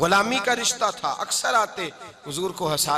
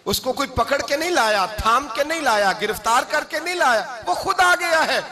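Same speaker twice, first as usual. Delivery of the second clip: higher-pitched preaching, building in force; impassioned preaching; 6 semitones higher, 3 dB louder than usual. Acted. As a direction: drive each phrase harder, climbing to a peak. (जोशीली तक़रीर, चढ़ती आवाज़ में)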